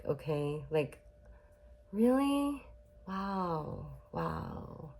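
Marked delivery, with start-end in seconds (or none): 1.9-4.9 hollow, hushed mock amazement; feigned interest. Imitating a parent who can't validate the child, feigned interest. Mocking parent's response to good news.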